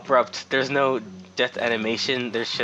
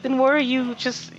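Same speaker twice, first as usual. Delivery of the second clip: high-pitched exasperated retort; 12 semitones higher than usual. Retold or imitated. Cashier responding to a customer who cursed him, exasperated. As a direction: retell it as a baffled protest.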